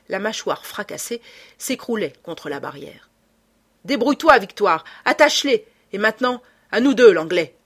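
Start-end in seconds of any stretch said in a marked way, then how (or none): 3.8-7.6 loud, high battle cry; shouted orders. Acted. Lupin shouts to Victoire and dares the Englishman, loud. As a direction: roar the orders mid-fight, then challenge.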